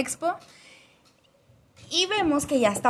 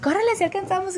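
mock urgent brother; 9 semitones higher, faster than usual. Brother urging to run, retold.

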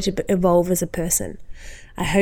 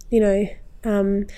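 ordinary talk

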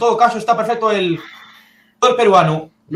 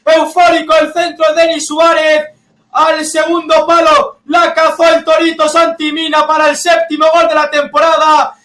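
high, loud euphoric goal call; breakneck goal commentary; 7 semitones higher, 3 dB louder than usual. Real, not acted. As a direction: shout the goal like a live commentator. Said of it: "que estoy nervioso y estoy, vamos, eufórico".

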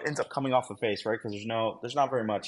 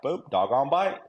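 brisk, louder call at higher pitch; mock call-out; 6 semitones higher, 6 dB louder than usual. Handler reporting that his dog has bitten, called out.

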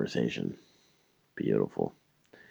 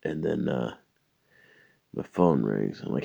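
usual speaking voice

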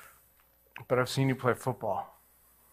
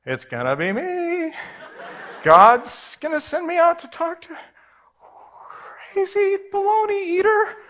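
mock dread; 7 dB louder than usual. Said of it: "dreading meeting the kid".

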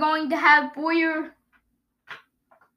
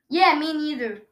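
ordinary talk

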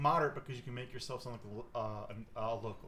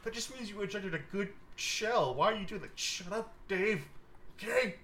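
higher, pleading voice of a flustered local; bickering local; 9 semitones higher, 4 dB louder than usual. Acted. Local hisses at Dave to back him up, higher and fretful. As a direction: scold a partner under your breath. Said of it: "hear someone go".

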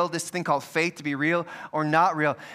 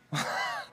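short high laugh; 9 dB quieter than usual. Real, not acted. Laughing at his own story.

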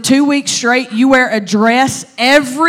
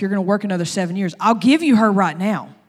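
rapid terms; faster than usual. Retold or imitated. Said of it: "Laban looks at him and is like".

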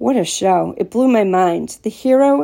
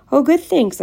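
higher-pitched, excited little burst; excited reply; 8 semitones higher, 3 dB louder than usual. A narrator's reading. Boy answering Asal about how his day went, excited. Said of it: "I said excitedly".

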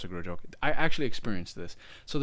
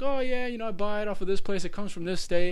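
raised, easygoing voice; playing the chill driver lightly; a hint of a casual stoner driver; 10 semitones higher, 4 dB louder than usual. Recounting the Uber driver's answer, lightly voiced stoner. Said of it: "And he's like".

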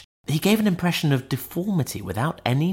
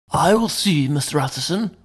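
servant's polite reply; 6 semitones higher than usual. A narrator's reading. Servant Poole answers the door.

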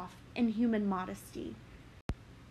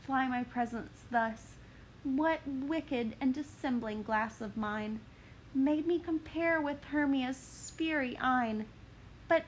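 put-on higher, breathless young woman; Helena lamenting; 3 semitones higher than usual. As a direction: mourn then startle at the body.

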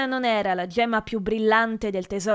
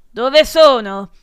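loud, slow demand; yelling; 2 semitones higher, 12 dB louder, slower than usual. A narrator's reading. Professor confronting the pupil about the leaves, yelling. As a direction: yell the question at the culprit. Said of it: "strillò il professor Prurity".

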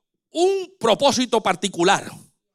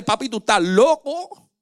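doubting son; 5 semitones higher than usual. Retold or imitated. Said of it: "los hijos a veces le dicen al padre".

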